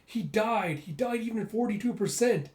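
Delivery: high, anguished cry of disbelief; mourning a comrade, crying out in grief; screaming and crying. Acted. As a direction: wail it out at the loss. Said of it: "screaming and crying as he goes".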